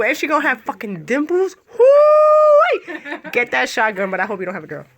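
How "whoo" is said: high, loud whoop with a laugh; laughing cheer, very high and loud; excited whoop. Real, not acted. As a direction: let out a loud joyful whoo. Cheering about future baby.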